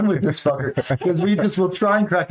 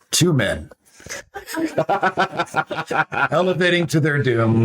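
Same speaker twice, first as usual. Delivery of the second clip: mock trailer narrator. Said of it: "self-narration of our adventures".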